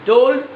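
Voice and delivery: টোল্ড with an unaspirated hard ট; টোল্ড opening on Bangla ট, not ঠ; mispronounced T. Shown as a demonstration. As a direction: say the T in টোল্ড without any puff of air. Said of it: "আমি ভুল উচ্চারণ করবো".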